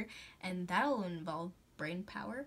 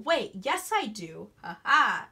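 usual speaking voice

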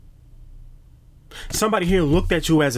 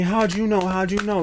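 higher startled question; mock surprise; 6 semitones higher than usual. Enemies caught out, asking in mock surprise. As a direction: caught off guard, a touch higher. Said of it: "I'm getting".

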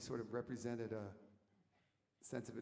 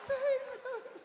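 very high, loud mock holler; crowd's cheering shout; 10 dB louder than usual. Friends greet the found boy, high imitated shout. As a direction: yell the name like an excited gang.

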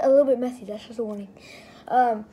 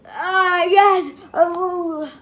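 startled loud yelp; sudden shocked shout; surprised cry; 5 semitones higher, 8 dB louder, much slower than usual. Real, not acted. Pie hits his face.

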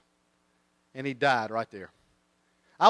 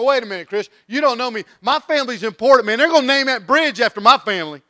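loud, high protest; indignant objection; 7 semitones higher, 9 dB louder than usual. Acted. Listener objecting to the preacher about family importance, indignant. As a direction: protest your importance at raised pitch.

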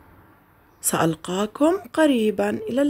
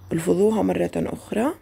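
usual speaking voice

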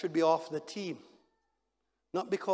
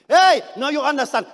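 usual speaking voice

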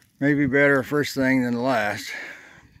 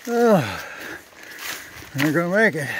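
out of breath; 5 semitones higher, 4 dB louder than usual. Real, not acted. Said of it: "The old man's getting tired".

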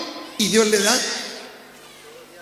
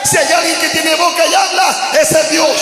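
loud, higher-pitched proclamation; fervent preaching; 5 semitones higher, 4 dB louder than usual. Real, not acted. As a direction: build to a full-force pulpit shout. Preacher proclaims at full force. (predicación ferviente a gritos)

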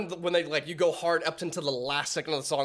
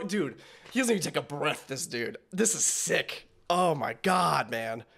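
ordinary talk

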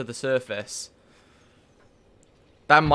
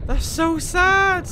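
much higher, slow and near tears; heartbroken; 4 dB louder, much slower than usual. Real, not acted. Player mourning the dead Sea Emperor, slow and tearful. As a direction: stretch out sad as if your throat is tight. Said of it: "I'm fucking tearing up again".

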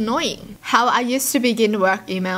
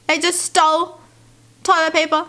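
high-pitched shocked question; incredulous; 6 semitones higher than usual. Real, not acted. Reacting to workplace toilet paper theft, incredulous. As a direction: voice jumping up in disbelief. Reacts to stolen tissue post.

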